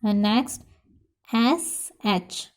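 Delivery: normal talking voice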